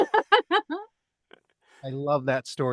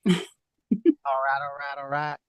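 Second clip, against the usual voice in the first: McConaughey impression; 3 semitones lower than usual. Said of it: "You could do George Bush".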